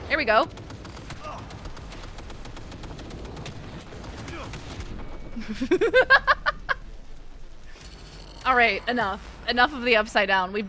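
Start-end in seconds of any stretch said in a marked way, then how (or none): 0.5-8.4 high laugh building from breathy start; real, drawn-out giggling fit; helpless laughter. Real, not acted. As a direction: laugh until it climbs high. Laughing during play.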